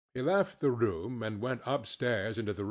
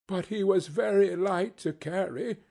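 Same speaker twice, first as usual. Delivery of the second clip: a hint of a cheerful mother, higher pitch; lightly voiced mother; 7 semitones higher, 3 dB louder than usual. A narrator's reading. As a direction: keep up the cheer, lightly acted. Mother resumes in figure's voice.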